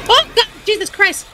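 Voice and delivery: jolted, pitch leaping up on the first words; startled outburst. Real, not acted. Player jumped by a robot in the game, startled.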